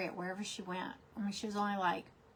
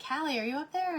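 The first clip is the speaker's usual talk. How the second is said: raised call up the stairs; calling upstairs; 7 semitones higher, 5 dB louder than usual. Retold or imitated. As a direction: call out loud to someone upstairs.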